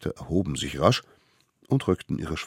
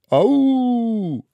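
high, loud solemn call; leading an oath; 8 dB louder than usual. A narrator's reading. Smallest one calling the oath. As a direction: proclaim each word.

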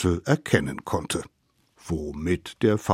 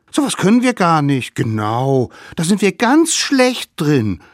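high, loud little voices; protesting little savages; 9 semitones higher, 8 dB louder than usual. A narrator's reading. Savages try to dodge nursing duty, protesting. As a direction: squeal excuses urgently.

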